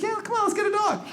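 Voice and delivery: high pleading whine; whiny pleading wife. Retold or imitated. Wife pleading with her husband for a dog, high whine. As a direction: beg like a spoiled kid. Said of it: "She's like".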